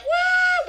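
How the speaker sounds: loud, high woo; yelling whoop. Retold or imitated. Fan stands up as he chooses them, yelled whoop. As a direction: yell out a fan's cheer.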